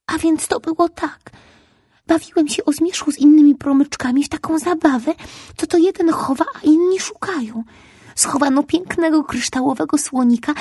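put-on high little boy telling his tale; a small lost child explaining; little ray boy. A narrator's reading. Ray boy tells his story. (wysoki głos małego promyczka)

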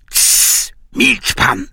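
sharp hushed whisper; hushing whisper. A narrator's reading. Young man hushes Iwan under the bed, whisper. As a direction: shush him in a whisper.

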